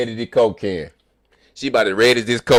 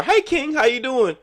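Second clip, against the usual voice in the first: upstairs workers greeting. Retold or imitated. Strangers greeting him.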